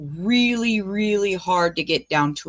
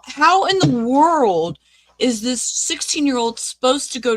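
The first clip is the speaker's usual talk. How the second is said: outraged disbelief; 4 semitones higher, 4 dB louder than usual. Real, not acted. Outraged reaction to the victim's story.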